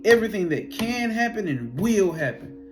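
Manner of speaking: indignant disbelief. Retold or imitated. Retold Watcher in disbelief.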